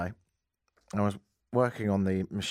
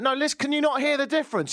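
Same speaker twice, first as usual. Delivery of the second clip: high, insistent pleading of the point; hammed-up exasperation; exasperated mock argument; 3 dB louder than usual. Arguing with the driver over horns, exasperated and high.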